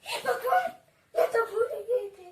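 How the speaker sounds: mother weeping in regret; tearful dismay. Acted. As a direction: break down remembering she's blind. Mother remembers daughter is blind.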